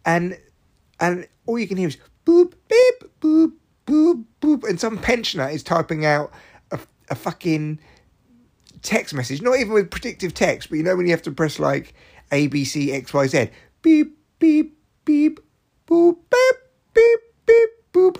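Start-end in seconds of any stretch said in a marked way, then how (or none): 2.3-4.7 loud, high beeps and boops; imitated key tones. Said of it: "still have the key tone on". 13.8-18.2 piercing high, loud beeps; imitated key tones. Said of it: "still have the key tone on".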